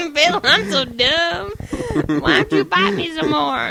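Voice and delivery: high, loud, drawn-out dopey voice; mock dim heroine. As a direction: ham it up slowly through laughter.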